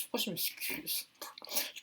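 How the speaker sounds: a conspiratorial whisper of self-mockery; whispered aside. Real, not acted. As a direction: whisper it to yourself, half amused. Aside mocking her own apology. (chuchoté en aparté)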